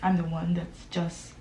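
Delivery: ordinary talk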